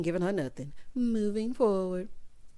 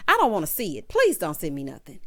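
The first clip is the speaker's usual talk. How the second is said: usual speaking voice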